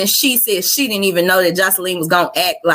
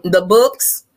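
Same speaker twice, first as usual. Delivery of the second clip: ordinary delivery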